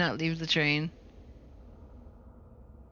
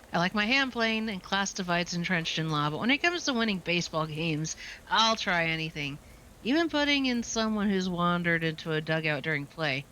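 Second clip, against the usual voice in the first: ordinary talk, nothing marked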